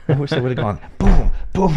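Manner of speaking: loud, with fists pounding the table; banging the desk. Real, not acted. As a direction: thump the table on each boom. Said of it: "I gotta quit hitting the table 'cause it messed up the mic".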